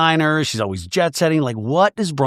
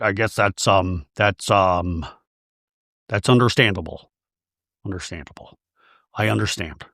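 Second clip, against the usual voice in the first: lightly voiced low, halting husband; lower-pitched mock of a man at a loss for words; gruff reluctant man; 5 semitones lower than usual. Voicing Todd, a gruff reluctant man.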